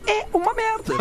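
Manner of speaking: normal talking voice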